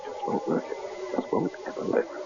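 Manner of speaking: under-breath whisper to himself; whispered grumble. Acted. Husband grumbling at stubborn wife, whispered. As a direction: hiss it low so she can't hear.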